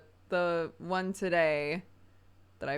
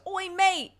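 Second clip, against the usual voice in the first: a much higher, louder Cockney "Oi, mate!"; mock Cockney shout; 13 semitones higher, 7 dB louder than usual. Toddler Clark calling across the yard, mock Cockney shout. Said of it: "Clark from across the yard goes".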